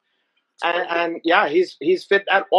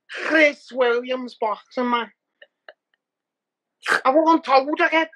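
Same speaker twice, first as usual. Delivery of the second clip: higher Scouse bragging; Liverpool accent; 5 semitones higher than usual. Retold or imitated. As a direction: crow like a Scouse fighter. Imitating Pimblett's Scouse brag.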